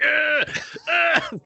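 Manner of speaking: high-pitched mock grunts; exaggerated comic grunting; imitated straining grunt.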